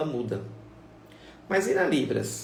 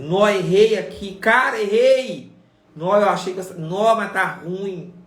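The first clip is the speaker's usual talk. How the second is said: higher, louder, slowly drawn-out dismay; dismayed groan; 6 semitones higher, 10 dB louder, slower than usual. Acted. Learner watching their first signing video, dismayed and drawn out. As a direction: moan over your own mistakes, stretching every word.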